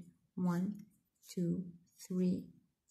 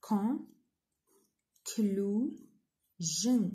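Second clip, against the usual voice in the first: "Conclusion" in three separate beats, long pauses between; split into syllables; 3 dB louder, much slower than usual. Shown as a demonstration. Teacher dividing a word for students, "Conclusion" syllable by syllable. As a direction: break "Conclusion" syllable by syllable: con, clu, sion. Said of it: "نقسموها into syllables".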